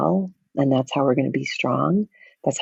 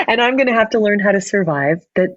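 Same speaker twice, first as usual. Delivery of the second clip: laughing admission; 6 semitones higher than usual. Real, not acted. Laughing at her own discomfort.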